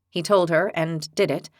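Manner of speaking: usual speaking voice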